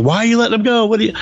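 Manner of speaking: high, incredulous mock outrage; mock indignant ladies. Retelling comic panel, ladies scold the pilots in high mock outrage.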